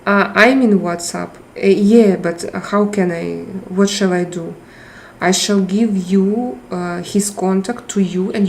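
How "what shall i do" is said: ordinary talk, nothing marked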